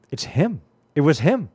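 suddenly thrilled, it's really him; retold awestruck whisper-turned-exclaim; excited realization. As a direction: voice jumps up with a hint of disbelief.

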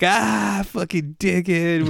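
high, drawn-out mocking cry; retold jeering. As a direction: imitate friends jeering at a clumsy pal, stretched out. Group jeering at man who fell.

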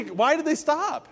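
high, slowed rising question; rhetorical question, drawn out. Acted. Preacher asking the congregation, slow high rhetorical question. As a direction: ask it to make them think. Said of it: "But why did they".

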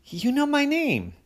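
put-on high amazed child; surprised little girl. A narrator's reading. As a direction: marvel like a small kid. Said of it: "Poppy said".

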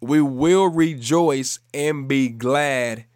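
emphatic, measured scripture proclamation; preaching scripture. Real, not acted. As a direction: declare the verse with conviction.

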